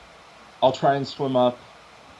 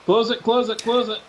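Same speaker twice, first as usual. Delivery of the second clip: high, urgent repeated command; urgent shouting; 10 semitones higher, 3 dB louder than usual. Acted. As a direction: yell it in alarm. Water gushing in, urgent call.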